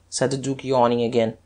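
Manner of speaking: plain reading voice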